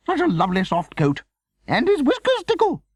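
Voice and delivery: high, loud gushing; delighted exclaiming. A narrator's reading. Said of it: "she exclaimed delightedly".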